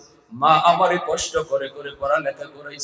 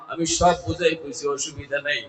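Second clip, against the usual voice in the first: ordinary talk, nothing marked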